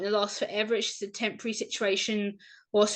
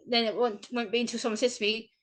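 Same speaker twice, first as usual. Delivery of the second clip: ordinary delivery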